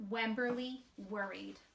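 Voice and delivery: usual speaking voice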